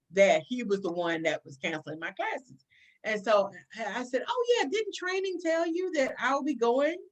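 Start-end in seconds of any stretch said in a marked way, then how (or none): 4.3-7.0 higher, airy mock surprise; feigned innocence. Officer asks whether training told the major, innocently breezy. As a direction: play it casual and unknowing.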